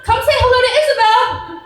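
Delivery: high, loud singsong call to the dog; mom calling the dog. Retold or imitated. Mother voiced calling the dog.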